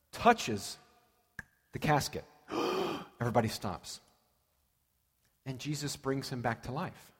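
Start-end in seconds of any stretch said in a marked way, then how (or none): 2.5-3.1 quick acted gasp of horror; shocked gasp. Preacher acting onlookers when Jesus touches the casket, shocked gasp. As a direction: suck in breath like a scandalized crowd.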